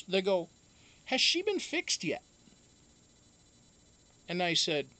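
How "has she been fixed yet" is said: high, light mimic of the vet asking. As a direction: lift into the vet's voice. Vet on the phone.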